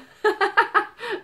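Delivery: loud, high burst of laughter; real laughter. Real, not acted. Vlogger cracking up at the energy drink can, real laughter. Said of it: "Sorry, I'm laughing".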